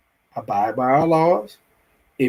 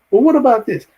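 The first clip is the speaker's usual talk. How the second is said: exasperated demand; 8 semitones higher, 5 dB louder, faster than usual. Pretend. Acted owner confronting squatters.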